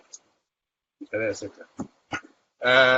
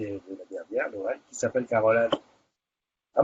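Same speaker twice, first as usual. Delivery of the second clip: usual speaking voice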